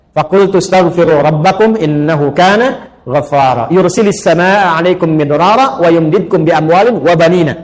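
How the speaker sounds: slow, melodic tilawah of surah Nuh; quran recitation. Acted. Preacher recites surah Nuh to the mothers, slow and melodic. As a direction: chant it like a reciter in the mosque.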